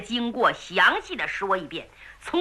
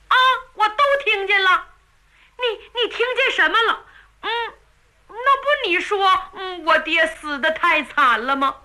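put-on higher boyish voice, quick and earnest; eager boy; 7 semitones higher than usual. A narrator's reading. Son telling his mother he heard everything, higher eager voice. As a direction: blurt it out like a keen child.

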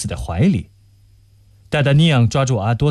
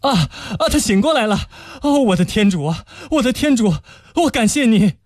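relieved joyful cry; 9 semitones higher than usual. Acted. Relief she wakes.

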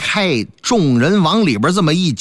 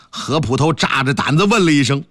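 ordinary delivery

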